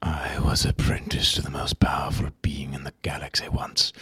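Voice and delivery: bitter about a stolen destiny; Starkiller voice. Shown as a demonstration. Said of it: "do the one if you got anything".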